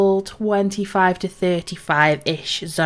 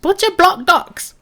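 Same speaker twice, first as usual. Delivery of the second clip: high, loud quest-giver greeting; Belinda; 8 semitones higher, 5 dB louder than usual. Acted. Quest-giver welcomes a traveller to the docks, higher lively role voice. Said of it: "So it's Belinda. She says".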